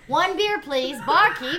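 calling loudly for the barkeep; loud bar order. Acted. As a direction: hail the barkeep, loud and high.

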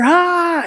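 high delighted yelp coming up from the water; gleeful toddler scream. Retold or imitated. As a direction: squeal like a two-year-old surfacing. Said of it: "he screamed out".

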